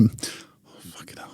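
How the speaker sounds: whispered curse fading to almost nothing; under-breath curse. Real, not acted. Kicked out of the dressing room, cursing under his breath. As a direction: mutter it to yourself so nobody hears.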